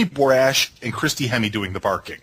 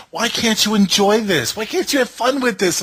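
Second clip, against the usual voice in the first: quoting Tink in a higher, lightly whiny voice; a mild mock Tink; 10 semitones higher than usual. Mock girlfriend scolding him about the show, higher voice. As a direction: mimic the admonishing girlfriend, pitched up. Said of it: "She's like".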